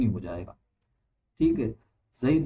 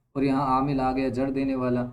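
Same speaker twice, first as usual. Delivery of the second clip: ordinary delivery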